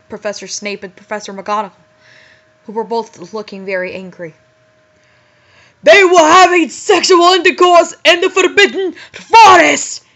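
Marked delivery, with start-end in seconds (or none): 5.8-10.0 high, loud outraged shout; furious yell. Acted. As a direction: yell it at the guilty students. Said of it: "he yelled in a furious voice".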